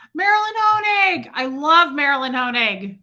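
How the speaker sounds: high, loud cry of delight; loud, gushing roll-call shout; excited greeting. Real, not acted. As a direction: belt out the name like spotting a favorite friend. Delightedly greeting a favourite viewer.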